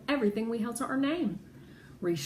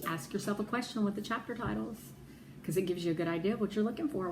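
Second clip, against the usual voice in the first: ordinary delivery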